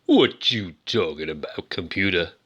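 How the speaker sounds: deep, sultry soul-man voice. Acted. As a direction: croon it low and sexy in a joke impression.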